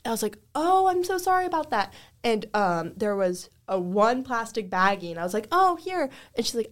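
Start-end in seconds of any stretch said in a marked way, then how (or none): 0.5-1.9 high, sugary service-worker apology; customer service voice. Retold or imitated. 5.5-6.1 high, sugary service-worker apology; customer service voice. Retold or imitated.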